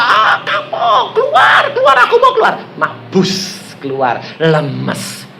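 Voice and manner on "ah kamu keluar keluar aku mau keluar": very high-pitched, strained shouting; high mock scream; retold demon shriek. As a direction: imitate a possessed woman yelling. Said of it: "Dia teriak-teriak".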